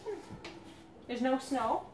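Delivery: ordinary delivery, nothing marked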